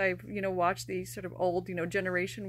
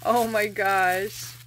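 slow, drawn-out, louder gasp of joy; thrilled exclamation; 5 dB louder, much slower than usual. Real, not acted. To viewers, seeing the plush pup at last: slow loud overjoyed exclamation.

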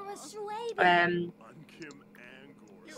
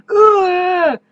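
high, loud imitated ugh; mock groan; 10 semitones higher, 9 dB louder than usual. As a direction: groan it like a grumpy kid. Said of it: "And he's like".

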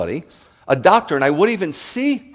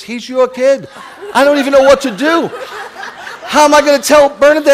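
high, loud fake panicked confession, laughing through it; mock panic; 12 semitones higher, 7 dB louder than usual. Rabbi pranking a congregant with a mock panicked confession. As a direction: spin a fake scandal in a rising frantic voice. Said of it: "and I said".

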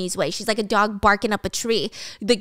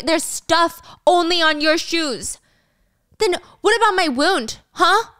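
higher, indignant arguing voice; accusing, pitched up; heated accusation; 6 semitones higher, 4 dB louder than usual. Acted. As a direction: act out throwing blame back in a quarrel. Acted heated accusation in a quarrel.

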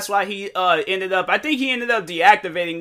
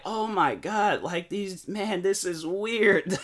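hushed, uneasy retold reaction; weirded out; 3 semitones higher, 6 dB quieter, slower than usual. Streamer reacting to a sobbing fan, weirded out. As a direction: drop the volume, drag out the disbelief. Retells reaction to fans crying over them.

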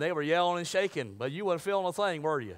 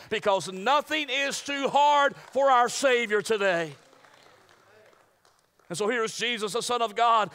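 raised, emphatic preaching voice; 6 semitones higher, 6 dB louder than usual. Acted. Preacher building up to his congregation, emphatic.

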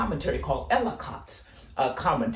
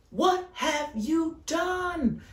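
slow, high, grave question; God asking Cain, slow and high; 11 semitones higher, much slower than usual. Acted. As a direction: draw it out heavy with grief. Preacher voicing God's question.

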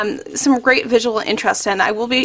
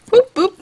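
high playful "boop, boop"; little sing-out boops, higher pitched; 10 semitones higher, 4 dB louder than usual. Shown as a demonstration. Crafter voicing each paper punch, playful high boops.

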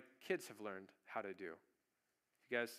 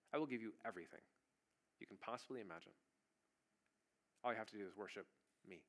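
softer, low seductive devil, rushing the last line; tempting devil; 5 semitones lower, 8 dB quieter, faster than usual. Acted. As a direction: lure him in quietly, hurrying the ending.

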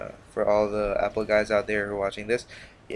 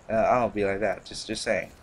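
ordinary talk

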